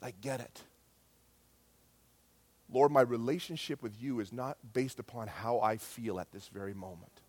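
low, soft, subdued prayer; humble prayer. Retold or imitated. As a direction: pray quietly, as if lying face down before God. Retold humble prayer on the floor.

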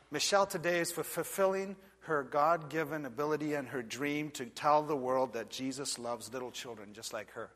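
ordinary talk

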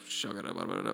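low quiet babble of nonsense syllables; quiet mock glossolalia; mock tongues babble. Shown as a demonstration. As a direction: rattle off gibberish like tongues. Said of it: "praying in tongues like".

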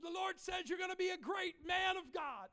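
high preacher's shout; prophesying. Retold or imitated. Preacher prophesying over a crying kid, high fervent shout. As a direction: proclaim it in a high, strained cry.

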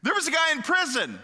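"There was a guy in prison" blurted high and loud; excited blurt. Acted. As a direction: burst out with the news. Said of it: "Yeah, sorry, I forgot about this".

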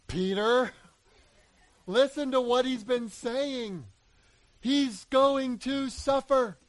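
high, loud, slow pleading as God the Father; God the Father pleading with Peter, loud and high; begging plea. Acted. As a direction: beg Peter to listen, drawn out and urgent. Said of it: "begging and saying it the way I'm saying it".

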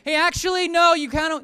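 mock yell through the door; mock shouting. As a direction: yell it at the door, half joking. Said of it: "start shouting at their door like".

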